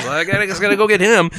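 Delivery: fast, rushed Spider-Man aside; hurried hero voice. Retold or imitated. Spider-Man heading into the rubble, quick mock urgency. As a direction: rattle it off like a hero in a hurry. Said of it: "And he says".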